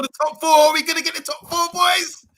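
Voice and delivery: high, exuberant fan cry; excited cheer. Real, not acted. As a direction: whoop it up for the team, high-pitched. Fan cheering for a top-four finish.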